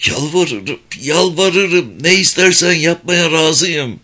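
high, desperate begging; pleading cry. A narrator's reading. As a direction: beg through tears. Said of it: "ağlama ve inleme arasında".

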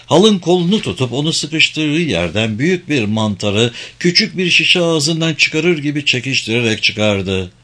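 usual speaking voice

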